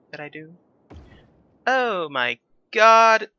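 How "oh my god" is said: loud, drawn-out gasp of shock; surprised shriek. Real, not acted. Streamer shrieking at a cute sight, loud and stretched. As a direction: blurt it out, stunned and slow.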